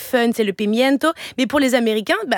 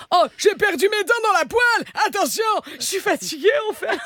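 high-pitched, hammed-up old lady; old woman; 7 semitones higher than usual. Mum playing old lady for her kids, hammed up. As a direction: wail it high like a toothless granny. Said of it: "fais la vieille".

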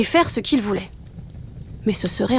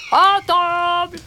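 slow, drawn-out, higher call to dinner; alpha wolf call; 8 semitones higher, 3 dB louder, much slower than usual. A narrator's reading. Father wolf summoning the pack to dinner, slow drawn-out call. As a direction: call the whole pack to the table. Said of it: "de sa grosse voix d'alpha".

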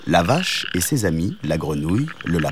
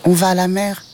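very high tiny kitten; high kitten calling; little kitten voice; 11 semitones higher than usual. A narrator's reading. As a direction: call up high like a small kitten. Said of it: "répondit le chat".

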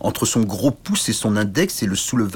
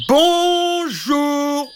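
tiny knight calling; 4 dB louder than usual. Acted. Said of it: "re-, refit le petit chevalier".

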